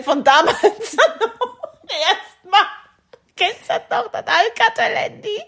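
high-pitched laughter through the words; giggling high and loud; laughing. Real, not acted. Laughs recalling old phone.

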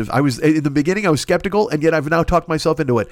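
ordinary talk, nothing marked

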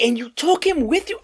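incredulous. Retold or imitated. Boss on phone to manager, squeaking in disbelief.

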